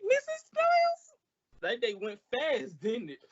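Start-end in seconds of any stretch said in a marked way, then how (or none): 0.0-1.6 much higher, sing-song falsetto; mock-sweet greeting. Costello sweetly greeting furious Missus Niles. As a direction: coo her name innocently.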